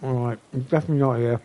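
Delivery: chewing while talking; mouth full. Real, not acted. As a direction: talk through a mouthful. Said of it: "Sorry for the chewing noises".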